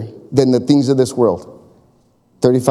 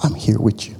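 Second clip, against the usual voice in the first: soft, low, half-whispered reassurance; a softly voiced God comforting; calm God; 4 semitones lower, 5 dB quieter than usual. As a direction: quietly promise you've got it. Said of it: "He goes".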